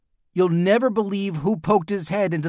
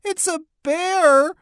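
very high, slow squeal of alarm; shriek; 13 semitones higher, 4 dB louder, slower than usual. A narrator's reading. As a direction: squeal it out, stretching the last word. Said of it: "Emily shrieked".

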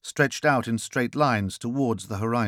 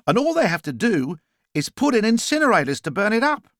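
higher-pitched, emphatic explainer; explaining character; 10 semitones higher, 4 dB louder than usual. Acted. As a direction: explain it high with punched key words. Character's dialogue in narrated story.

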